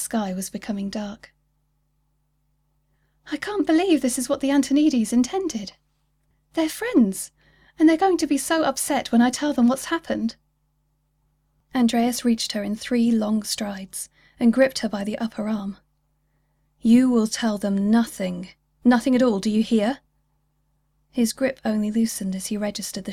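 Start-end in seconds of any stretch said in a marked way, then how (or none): 3.3-10.4 higher, upset woman's voice; pleading, close to tears; upset young woman. Acted. Kizzy upset about the Antonides. 16.8-20.0 stern command. Acted. Andreas grips her, commands.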